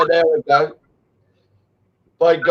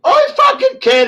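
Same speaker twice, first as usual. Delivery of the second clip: cracking cry. Real, not acted. Repeating to critics he's not faking, voice breaking. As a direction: voice jumps far higher, breaking. Insisting to his attackers.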